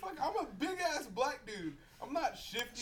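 protesting loudly among friends; indignant protest. Real, not acted.

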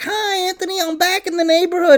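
very high, put-on female voice; mimicked woman's greeting. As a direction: squeal a high hello, imitating her. Imitating Bible Belt woman greeting.

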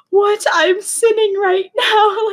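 high, slow, alarmed voice; mock shocked child. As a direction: play the startled churchy kid. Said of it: "And I'm like".

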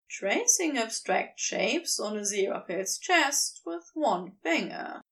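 ordinary delivery, nothing marked

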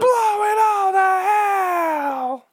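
slow, high dramatic yell; shout. Pretend. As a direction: yell it out, drawn out. Heroes decide to blow it up.

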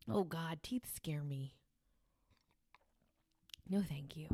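hushed, breathy dread of teeth; scared whisper. Real, not acted. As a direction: whisper it like teeth truly frighten you. Mock horror at teeth.

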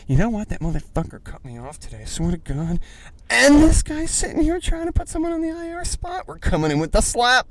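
high-pitched hammed-up angry fantasy manager; mock furious rant. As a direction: whip up into a cartoonish squeal of rage. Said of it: "and you're just like".

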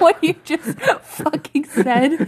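slow words through laughter; laughing disbelief. Real, not acted. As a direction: squeeze it out mid-laugh.

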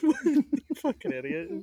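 high, slow mocking insult. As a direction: drag it out in a squeaky voice. Mock insult, repeated.

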